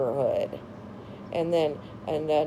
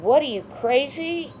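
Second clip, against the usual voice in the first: mock angry retort; 8 semitones higher, 3 dB louder than usual. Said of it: "she acted so mad and said".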